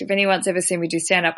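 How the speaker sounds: normal talking voice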